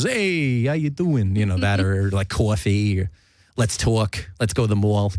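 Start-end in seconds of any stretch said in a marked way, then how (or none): 0.0-1.4 exaggerated Sopranos-style Jersey greeting; cartoonish Jersey accent. Host showing what he doesn't sound like, cartoon Jersey. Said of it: "cartoonish, like, Jersey Shore Sopranos". 2.3-3.0 COFFEE as a cartoon Jersey "caw-fee"; exaggerated Jersey accent. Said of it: "in that exaggerated a way". 3.6-5.1 cartoon Jersey "tawk" and "mawl"; exaggerated Jersey accent. Host parodying how people expect him to sound, broad Jersey. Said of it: "in that exaggerated a way".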